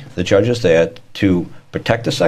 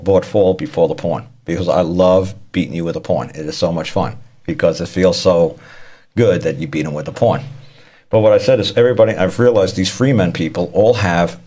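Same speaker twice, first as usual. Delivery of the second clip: ordinary talk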